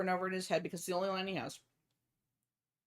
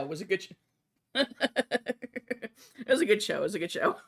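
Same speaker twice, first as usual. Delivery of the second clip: laughing through the words; 4 dB louder than usual. Real, not acted. Storyteller laughing at his own punchline. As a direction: chuckle it out. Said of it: "laughing repeat".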